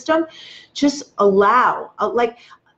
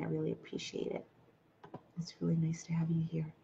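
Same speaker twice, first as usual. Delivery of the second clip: whisper; 4 semitones lower, 17 dB quieter, faster than usual. Real, not acted. Soft thanks to joining viewers.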